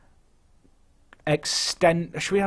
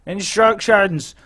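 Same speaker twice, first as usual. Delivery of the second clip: slow, drawn-out yell, higher and louder; frustrated shout; 5 semitones higher, 5 dB louder, much slower than usual. Real, not acted. Manager yelling at himself after forgetting to pause, slow loud shout. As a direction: bark it out slowly in frustration.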